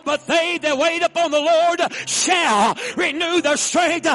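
high, loud, driving preacher delivery with gasped line ends; full-force preaching. Real, not acted. Preacher urging the congregation to shout along, full-force preaching. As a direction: preach it hard to the crowd.

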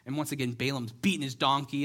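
normal talking voice

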